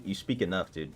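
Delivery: ordinary delivery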